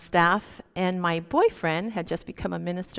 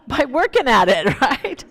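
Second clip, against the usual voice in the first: laughing; 4 semitones higher, 8 dB louder than usual. Real, not acted. Laughs at her own lesson.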